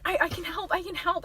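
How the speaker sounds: soft, halting reassurance; acted gentle offer. Girl offers to bandage the boy, soft halting voice. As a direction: stammer it quietly, eager to help.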